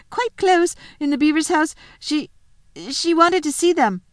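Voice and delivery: put-on high, nervous boy gabbling his message; trembling. Edmund gives the wolf his message, trembling and high. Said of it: "trembling so that he could hardly speak".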